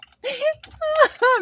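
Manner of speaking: squealing giggle; high delighted laugh. Real, not acted. Streamer laughing at Beth's joke, high giggle.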